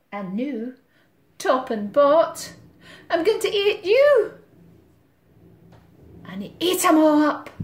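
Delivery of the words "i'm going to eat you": high, loud, fast gobbling threat; menacing cat. Acted. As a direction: pounce on the line like a greedy cat.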